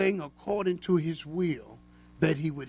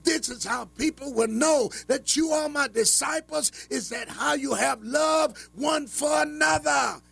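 fervent preaching; 9 semitones higher than usual. Acted. Radio preacher exhorting believers to love one another, fervent preaching. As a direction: preach it high and impassioned, stretching the phrases.

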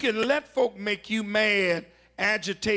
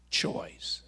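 dramatic whisper; 6 dB quieter than usual. Acted. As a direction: breathe the word out in a whisper. Repeats hushed key word.

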